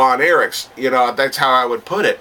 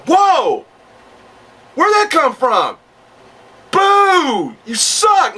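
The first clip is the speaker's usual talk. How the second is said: high, drawn-out mock crowd jeering; imitated crowd outcry; 13 semitones higher, 3 dB louder, much slower than usual. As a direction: yell like outraged fans in the stands. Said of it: "fans would go".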